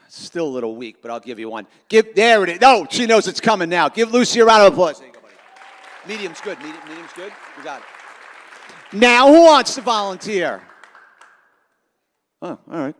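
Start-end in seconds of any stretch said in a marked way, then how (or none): none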